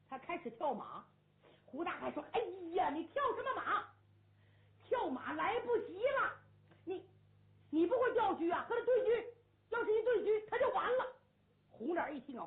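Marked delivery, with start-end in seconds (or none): 2.3-11.1 excited kibitzing. Acted. Onlooker butting into chess game.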